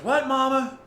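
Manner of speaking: put-on high, loud child's "What, Mama?"; a girl answering her mother from afar; daughter calling. Daughter calling back to her mother, high and loud. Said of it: "My daughter says".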